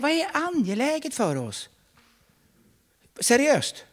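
high, pressing question; urgent challenge. Real, not acted. Preacher challenging the congregation about priorities, urgent. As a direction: demand an honest answer.

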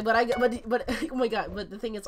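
normal talking voice

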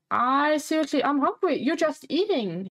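playful indignant whine; mock infant complaint. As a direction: complain like a hard-done-by baby. Voicing a hungry infant's complaint.

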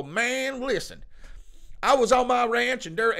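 high-pitched mock country accent; dumb-sounding country accent. Imitating someone who doesn't sound smart, mock country accent. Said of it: "that guy doesn't sound very smart".

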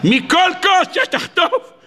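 very high, drawn-out cry; impassioned preaching. Acted. Preacher promising a lesson from every thorn, impassioned cry.

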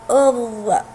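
isolated emphatic interdental ẓ in "أض-"; letter sound demo. Shown as a demonstration. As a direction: demonstrate "أض-", tongue tip between the teeth. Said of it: "هكذا ينطق حرف الضاد".

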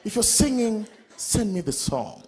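soft, low, slow love line; mock romantic text. Man reading his romantic text to his girlfriend, softly. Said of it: "I told her".

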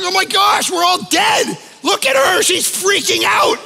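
mock panic, as if the plane is going down; panicked passenger. Passenger sees the flight attendant panic, mock panicked cries. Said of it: "You'd be like".

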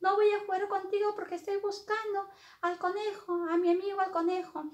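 put-on high-pitched storybook girl refusing; defiant Alicia. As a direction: stand up to the queen in a high girlish voice. Alicia refuses the angry queen.

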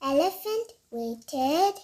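ordinary delivery

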